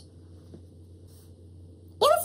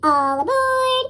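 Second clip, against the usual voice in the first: slow, drawn-out, higher call; stretched conductor call; conductor's cry; 7 semitones higher, slower than usual. Acted. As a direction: sing it out across the platform. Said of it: "cried the conductor".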